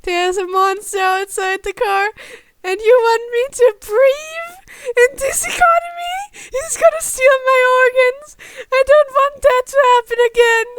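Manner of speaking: high, loud, tearful wailing; sobbing panic. Acted. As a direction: sob it out in terror of the monster. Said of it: "he sobbed".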